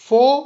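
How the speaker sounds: "फो" stressed and drawn out; stressed syllable. Shown as a demonstration. Teacher splitting forest into two syllables, "फो" stressed. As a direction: hit "फो" as the accented syllable. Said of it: "फो जो है stressed है".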